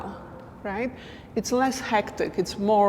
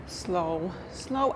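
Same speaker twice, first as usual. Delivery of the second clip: soft, spaced-out counting of the slow steps; slow rhythm cue; 7 dB quieter than usual. Shown as a demonstration. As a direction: say each slow on its own long beat. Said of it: "I would do two slow".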